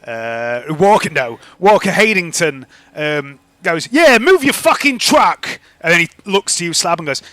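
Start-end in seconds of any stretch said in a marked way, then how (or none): 3.9-5.6 slow, high, loud command; angry order. Acted. Shopkeeper sending the delivery driver away, angry. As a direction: order him off, drawing it out loudly.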